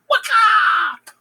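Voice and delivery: high, loud "waka!"; comic slaying cry. Acted. Killers striking down Banquo, high comic attack cry. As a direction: shout it as a cartoon killing blow.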